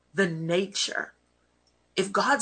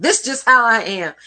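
defiant quote; 5 semitones higher, 10 dB louder than usual. Retold or imitated. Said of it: "I'm not talking about".

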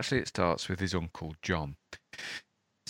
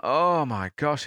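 weary uncle; 6 semitones higher, 5 dB louder, slower than usual. Retold or imitated. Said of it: "he's like".

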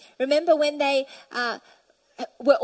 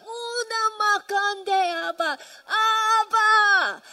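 high, sustained cry of worship, building louder; 6 semitones higher, 3 dB louder, much slower than usual. Shown as a demonstration. Worshipper crying to God from the depths, crying out. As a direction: cry it out long and high to heaven. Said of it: "We're crying out".

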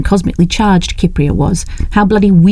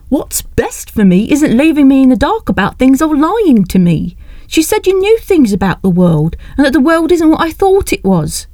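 higher, pleading and urgent; upset young woman; 8 semitones higher than usual. Acted. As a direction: demand the truth, voice climbing. Leslie demands the truth.

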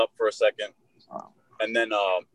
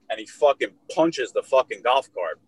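ordinary delivery

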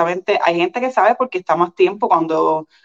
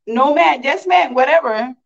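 higher, panicked yes-ma'am no-ma'am jumble; flustered babble; 8 semitones higher, slower than usual. Retold or imitated. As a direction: blurt answers at random, lost and scared. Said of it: "Yo estaba mirándola, diciendo". (balbuceo agudo y nervioso, sin entender nada)

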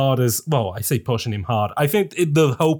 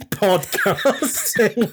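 high laugh breaking into the word; 6 semitones higher, much slower than usual. Acted. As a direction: laugh out the last word slowly. Laughing through announcement.

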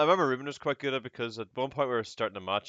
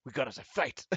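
a cool rival sizing up his opponent; lightly voiced anime fighter; anime rival moment; 8 dB quieter than usual. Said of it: "It's like the anime moment".

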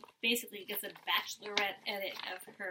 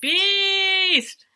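higher, louder shout of the word; louder, higher mock cheer; echoed cheer; 9 semitones higher, 13 dB louder than usual. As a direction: yell it in delight. Echoing the cheer.

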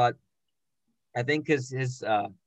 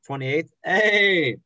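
excited; 4 semitones higher, 5 dB louder, much slower than usual. Real, not acted. Cheering a climber.

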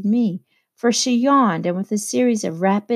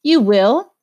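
curt and louder, a clipped retort; answered shortly; 4 semitones higher, 7 dB louder than usual. A narrator's reading. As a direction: shut him down fast. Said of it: "I answered shortly".

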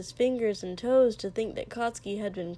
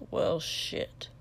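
mutter; 5 semitones lower than usual. A narrator's reading. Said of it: "and muttered".

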